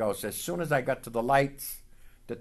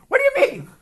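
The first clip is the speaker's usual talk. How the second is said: much higher, louder incredulous brother; baffled question; 10 dB louder, faster than usual. Retold or imitated. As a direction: squeak it out in disbelief.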